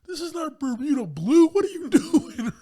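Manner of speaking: very high, loud, laughing mock snob; snooty neighbor voice. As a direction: shriek it like an outraged socialite. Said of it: "is like".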